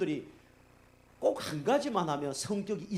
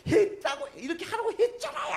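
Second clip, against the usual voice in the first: shouted much higher and louder, scolding; exasperated yelling; 7 dB louder than usual. Retold or imitated. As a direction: lose patience and yell at the driver. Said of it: "완전히 이성을 돌아갔고".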